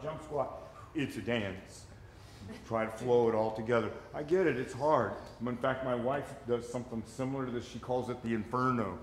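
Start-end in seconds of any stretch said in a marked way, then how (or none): none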